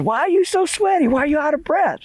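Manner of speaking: very high-pitched grandma voice; high falsetto old-lady questioning; alarmed grandma. Acted. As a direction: squeal the questions like a startled grandmother. Grandma questions the panicked boy.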